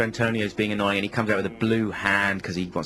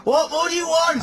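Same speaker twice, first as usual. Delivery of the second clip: much higher, louder yelled repeat; 6 dB louder than usual. Acted. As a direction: scream the repeat.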